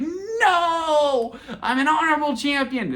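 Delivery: high, drawn-out mock cry of protest; upset wail. Wrestler protesting after seeing the replay, upset wail. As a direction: wail it loudly, imitating an upset champion. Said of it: "he was so upset".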